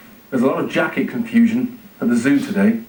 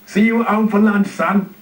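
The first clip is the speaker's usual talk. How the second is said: bright and higher goodbye; cheery; 8 semitones higher than usual. A narrator's reading. Zookeeper cheerily calling goodbye to his son, upbeat and higher. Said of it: "he says cheerily".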